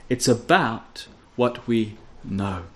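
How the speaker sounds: sincere low close on know; quiet, slow emphasis. Real, not acted. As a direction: settle it quietly. Contrast of feel and know.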